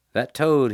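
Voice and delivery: "That toad" pushed high and loud; emphatic. A narrator's reading. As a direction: land hard on toad. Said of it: "he said emphatically".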